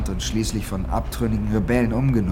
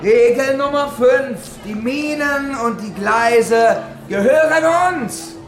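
high, loud and slow, every word hammered; shouted rule; 12 semitones higher, 4 dB louder, slower than usual. Acted. Boss roaring the gang's rule at the miner, furious. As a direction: bark the rule like an order.

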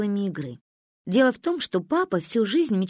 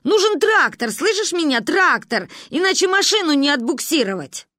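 agitated; 7 semitones higher, 3 dB louder than usual. A narrator's reading. Father reports car stuck in mud.

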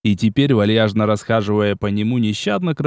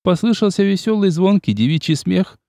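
ordinary delivery

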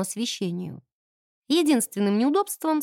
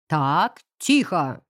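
booming command; 5 semitones lower, much slower than usual. Acted. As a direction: silence the crowd with a bark. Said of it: "громогласно провозгласил завхоз".

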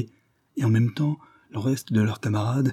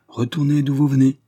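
louder, higher warning to intruders; raised-voice acted defiance; defiant order; 6 semitones higher, 6 dB louder than usual. A narrator's reading. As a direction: call out an order to turn back. Kulo orders the police back.

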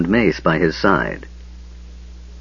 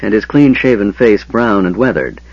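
plain reading voice

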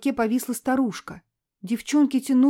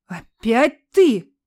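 angry; 9 dB louder, much slower than usual. Acted. Man angry at boy.

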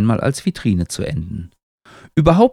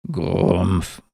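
low-key tree grumble; a short grumbled growl; mild growl; 3 semitones lower, 3 dB quieter than usual. A narrator's reading. Tree pulls beetle out of its thoughts, mild growl. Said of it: "knurrt Baum".

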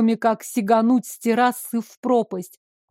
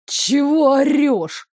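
grumbling; 4 semitones higher, slower than usual. A narrator's reading. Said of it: "Недовольно пробурчал незнакомец".

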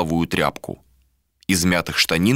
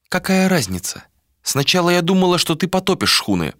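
high, urgent woman's voice; young woman voice; 9 semitones higher than usual. Acted. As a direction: speak up high, a little heated. Malika's voice, high.

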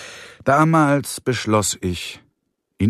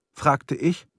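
usual speaking voice

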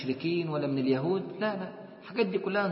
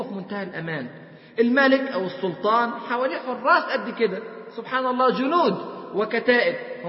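louder, higher voice, pressing each question; impassioned preaching; 5 semitones higher, 5 dB louder than usual. Acted. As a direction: hammer the greedy man's worries home. Preacher rails against greed, loud.

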